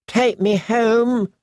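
put-on high, slow, drawn-out little boy's demand; demanding child. A narrator's reading. Rickon demanding to leave the ruins, high insistent voice. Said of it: "Rickon demanded".

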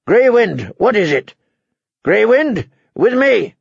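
sharp, high-pitched command; calling a wolf. Acted. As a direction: call the animal to heel, pitched well up. Robb calls his balking wolf.

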